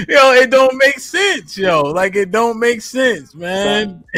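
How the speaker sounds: high, laughing through the words; breaking up mid-sentence. Real, not acted. Mocking grandma's eye doctor logic, laughing.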